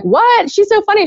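high-pitched mock surprise; retold disbelief. Someone defends her to a gossiping coworker, mock disbelief.

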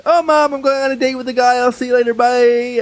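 chirpy pretend-casual voice to his mom; breezy cover story. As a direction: sell the innocent date excuse to mom. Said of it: "pretending like".

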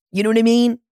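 normal talking voice

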